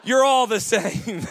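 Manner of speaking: co-pilot clinching the joke; slow punchline. Retold or imitated. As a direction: land the punchline slowly. Punchline delivered.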